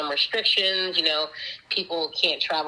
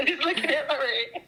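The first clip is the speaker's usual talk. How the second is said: much higher, spoken through a laugh; 10 semitones higher than usual. Real, not acted. Remembering looking at her ring that night, laughing. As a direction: giggle the words out.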